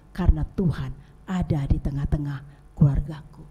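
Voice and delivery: low, hushed thankful prayer; grateful prayer. Acted. Believer thanking God each morning, low and hushed. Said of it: "dan berkata".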